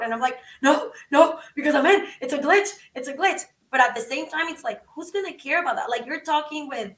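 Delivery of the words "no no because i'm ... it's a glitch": higher-pitched, insistent mock protest; reenacted pleading in short insistent bursts; retold stubborn denial. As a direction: refuse to accept it, point after point. Arguing back to doubting friend.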